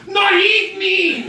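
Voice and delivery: high, drawn-out yell imitating the neighbour; retold panicked yell. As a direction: yell it loud and long, mimicking him. Retold neighbour yelling during dog fight.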